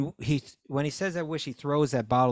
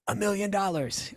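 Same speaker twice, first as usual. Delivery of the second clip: excited wish; 9 semitones higher than usual. Retold or imitated. Two friends making their wish to the genie, excited. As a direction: call out the wish in a raised pitch.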